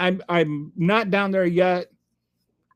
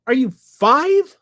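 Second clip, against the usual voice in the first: incredulous; 8 semitones higher, 6 dB louder, slower than usual. Real, not acted. Scornful retort to trolls.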